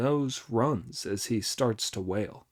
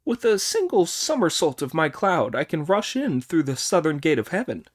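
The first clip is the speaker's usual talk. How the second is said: higher, louder, quick boastful voice; Monkey boasting; 4 semitones higher, 4 dB louder than usual. Acted. Monkey boasting his plan to Sanzang, higher and brisk. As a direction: rattle off the plan proudly.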